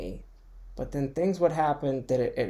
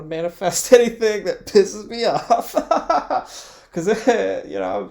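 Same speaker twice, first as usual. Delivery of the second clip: giggling through the words, voice climbing much higher and louder; laughing; 8 semitones higher, 9 dB louder than usual. Real, not acted. To podcast listeners, amused at her own worry, laughing. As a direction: laugh it out as the joke lands.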